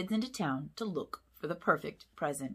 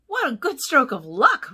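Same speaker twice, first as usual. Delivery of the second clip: louder, higher, delighted; excited pup; 7 semitones higher, 11 dB louder than usual. A narrator's reading. Puppy cheers the lucky find, loud and excited.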